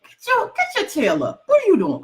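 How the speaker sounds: high-pitched mock yell at herself; imitating yelling at herself. Author retelling how she yells at herself, mock yelling. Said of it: "I yell at myself".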